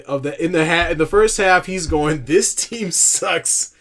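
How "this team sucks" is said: mock Bill Walton. Imitating Bill Walton's rant.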